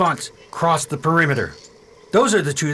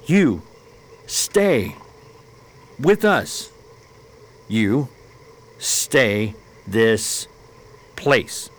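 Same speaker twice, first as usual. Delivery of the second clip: slow simple words; 5 semitones lower, much slower than usual. Acted. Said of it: "These people seem to like your voice".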